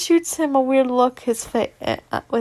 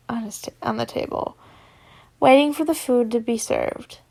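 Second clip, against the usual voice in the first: ordinary delivery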